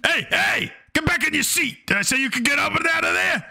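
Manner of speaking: put-on teacher yelling at a kid who stood up; angry order. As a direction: snap the kid back to the seat. Teacher orders kid back to seat.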